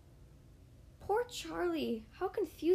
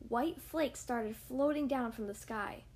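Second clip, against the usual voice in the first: plain reading voice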